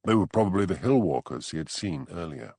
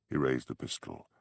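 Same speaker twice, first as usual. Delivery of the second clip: usual speaking voice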